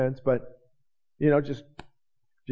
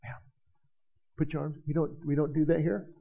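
hushed deacon scolding; 7 dB quieter than usual. Retold or imitated. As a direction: hush her like a disapproving deacon. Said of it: "the deacons will go and they'll go".

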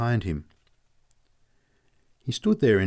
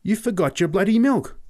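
high, loud astonished outburst; amazed, pitch leaping right up; 11 semitones higher, 5 dB louder than usual. A narrator's reading. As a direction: blurt out a startling discovery. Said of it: "I said in astonishment".

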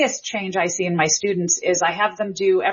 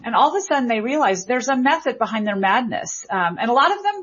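usual speaking voice

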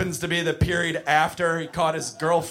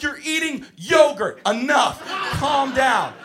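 raised, exasperated teacher voice; losing it; 9 semitones higher, 4 dB louder, slower than usual. Acted. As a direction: pitch rises as patience runs out. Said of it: "I just wanna scream at him".

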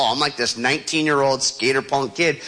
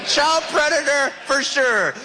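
alarmed; 10 semitones higher, slower than usual. Retold or imitated. Panicked inner thought.

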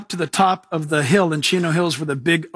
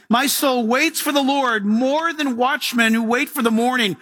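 raised, intoned psalm reading; 4 semitones higher than usual. Acted. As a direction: cry the psalm out to the Lord. Reads psalm.

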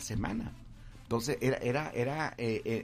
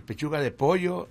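upbeat store-ad read, a bit louder; announcer pitch; 5 semitones higher, 8 dB louder than usual. Shown as a demonstration. Victor pitching his ad idea to the owner, upbeat ad voice. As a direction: sell the chicken price like a radio ad. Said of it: "diría lo que vamos a decir".

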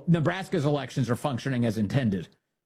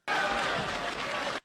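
soft breathy laugh; 5 dB quieter than usual. Real, not acted. Laughing at own bit.

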